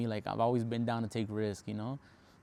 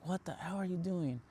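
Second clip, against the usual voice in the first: mock yell; 7 semitones higher, 4 dB quieter than usual. Cousin reacting to the van, higher-pitched mock outrage. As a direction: imitate the cousin freaking out, pitched up. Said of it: "starts, like, yelling at me".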